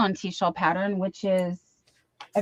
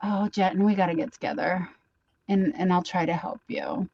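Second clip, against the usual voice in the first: ordinary talk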